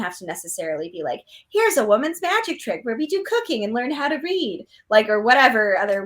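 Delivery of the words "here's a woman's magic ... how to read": put-on prim old-fashioned lady voice; a light old-timey housewife; old-timey woman voice. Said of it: "that's my old-timey woman voice".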